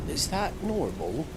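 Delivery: ordinary talk, nothing marked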